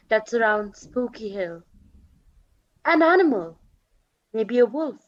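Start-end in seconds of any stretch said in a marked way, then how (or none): none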